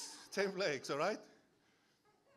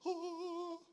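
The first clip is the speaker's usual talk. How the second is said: startled oh; 14 semitones higher than usual. Retold or imitated. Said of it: "get there and be like".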